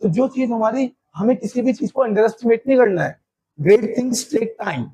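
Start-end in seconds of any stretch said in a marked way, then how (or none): 3.6-4.8 very slow, every word weighed; teacher stating a proverb slowly. Shown as a demonstration. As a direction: lay the saying out slowly. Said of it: "एक word होता है".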